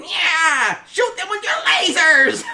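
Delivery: very high, loud, slow villain screech; Cobra Commander voice. Retold or imitated. Villain ordering laser fire on heroes, screeching high. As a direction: shriek orders like a cartoon villain. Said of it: "turn me into freaking Cobra Commander".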